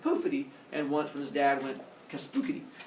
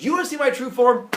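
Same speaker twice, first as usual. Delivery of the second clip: high, loud mock threat; fed-up challenge; 9 semitones higher, 10 dB louder than usual. Zeus about to show true form, loud challenge. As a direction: dare her at raised volume.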